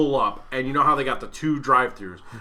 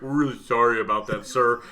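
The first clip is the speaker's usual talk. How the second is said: insincere staff apology; 6 semitones lower, 3 dB quieter than usual. Retold or imitated. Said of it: "all of the fucking empathy of a".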